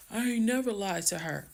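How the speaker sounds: mocking husband.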